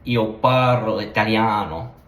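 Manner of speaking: Io parlo italiano, vowels drawn out, slow; Italian, drawn out. Shown as a demonstration. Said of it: "you draw it out more".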